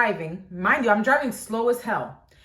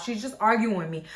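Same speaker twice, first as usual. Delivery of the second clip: normal talking voice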